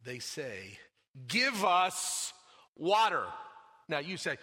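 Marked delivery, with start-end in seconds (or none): 1.3-3.3 loud, blunt demand for water. Retold or imitated. Preacher mimicking grumblers, a loud demanding order. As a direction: bark the demand like an ungrateful crowd. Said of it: "a lot of us that speak like this".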